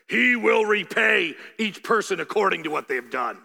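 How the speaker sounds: loud and forceful, landing hard on every word; emphatic shout. Shown as a demonstration. Preacher shouts Jesus's verdict to the congregation, loud and forceful. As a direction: declare it like a warning. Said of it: "let me say it this way".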